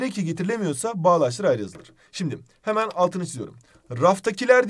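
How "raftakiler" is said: "Raftakiler" said higher and louder; test word called out. Shown as a demonstration. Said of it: "diyebiliyor muyuz?". (test kelimesini yüksek sesle söyleme)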